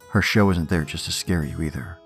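ordinary delivery

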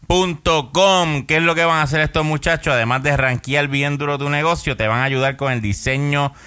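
voz de comercial de radio; lectura de anuncio. Acted. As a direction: vende como locutor de radio. Lee el anuncio del patrocinador. (anuncio publicitario)

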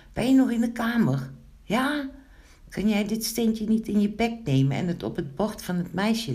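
high, quick, urgent asking; eager request. Acted. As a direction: call out a pressing favour to a friend. Pinkeltje calling eager request.

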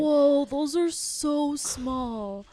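slow, high, loud amazed whoa; surprised. Real, not acted. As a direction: stretch it out in disbelief. Seeing tiny donuts.

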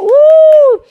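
long, high, loud "woo"; celebratory whoop. Real, not acted. Host celebrates the viewers who answered, high loud whoop. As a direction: let out a soaring whoop. Cheers another winner.

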